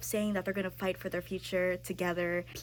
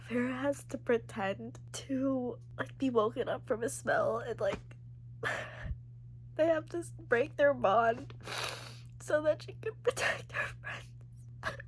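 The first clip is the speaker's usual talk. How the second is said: tearful; 4 semitones higher than usual. Real, not acted. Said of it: "I'm not okay right now".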